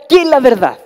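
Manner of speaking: Pilate's loud retort, voiced by a preacher. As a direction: fling the question back.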